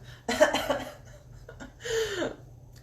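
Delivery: bright high burst of laughter; real laugh. Real, not acted. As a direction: laugh at your own joke. Laughs at own throat chakra joke.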